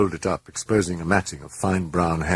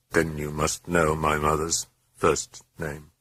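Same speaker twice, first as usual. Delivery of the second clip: ordinary delivery, nothing marked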